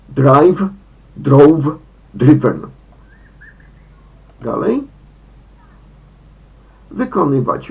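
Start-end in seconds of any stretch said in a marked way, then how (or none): 0.1-2.6 drive, drove, driven said loud and slow; English verb forms. Shown as a demonstration. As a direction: spell out each form by ear. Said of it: "w wymowie". (głośno wypowiedziane drive, drove, driven)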